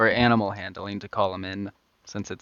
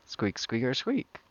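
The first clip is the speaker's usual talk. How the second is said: soft squeaky squirrel noises; squirrel squeaks; 3 semitones higher, 6 dB quieter, slower than usual. Acted. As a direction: chitter like a tiny squirrel. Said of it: "out pops out your little squirrel".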